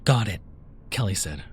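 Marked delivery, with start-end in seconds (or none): 0.0-0.4 firm, determined; resolute. Acted. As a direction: steel yourself. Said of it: "her voice resolute".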